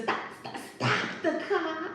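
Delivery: high drawn-out plea to stop; hammed-up frightened begging, slow and high; imitating a scared man pleading; mock panic. Character yelling to stop car.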